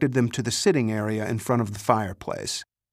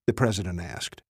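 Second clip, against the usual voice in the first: plain reading voice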